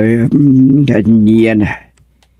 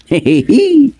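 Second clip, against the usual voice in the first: high burst of laughter; 14 semitones higher than usual. Acted. Comic banter about coffin business.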